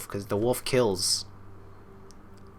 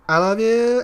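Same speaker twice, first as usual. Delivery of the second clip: very high, loud, drawn-out cry; escalating hammed-up lovesick shriek; frantic love declaration; 14 semitones higher, 11 dB louder, slower than usual. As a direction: squeal it out as the obsession peaks. Repeated declarations escalate to frantic peak.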